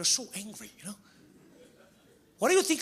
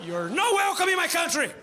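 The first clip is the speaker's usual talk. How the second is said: higher, louder mock outrage; mock bigot shout; 9 semitones higher, 3 dB louder than usual. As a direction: play the angry nativist, laughing through it. Mock xenophobe rant.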